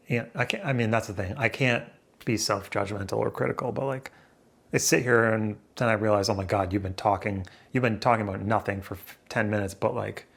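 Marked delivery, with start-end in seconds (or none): none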